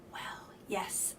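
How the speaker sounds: ordinary talk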